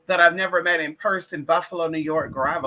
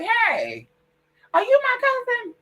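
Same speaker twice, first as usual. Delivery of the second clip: much higher, sing-song mock greeting; mimicking a cousin; slower than usual. Mocking the Facebook message from a cousin, high chirpy voice. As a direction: put on a high chirpy stranger voice.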